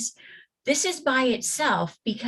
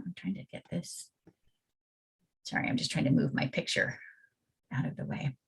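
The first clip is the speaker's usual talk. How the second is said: muttered aside; 6 semitones lower, 9 dB quieter, faster than usual. Real, not acted. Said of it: "aside while moving picture".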